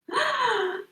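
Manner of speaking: sharp delighted gasp. Acted. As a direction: gasp in wonder at the baby. First sight of the baby.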